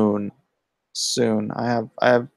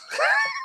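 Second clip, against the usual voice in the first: high squeal of laughter; 4 dB quieter than usual. Real, not acted. Laughs inside the mock voice.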